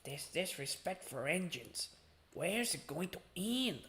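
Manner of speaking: soft, slow worried brake van; Toad. Acted. Toad lamenting disrespect for engines, soft slow worried voice. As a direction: lament it quietly and slowly. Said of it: "what's the best Toad voice".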